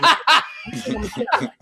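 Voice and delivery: I'ma squeaked very high through laughter; high-pitched giggling I think I'ma tell him; squeaky laughing repeat. Shown as a demonstration.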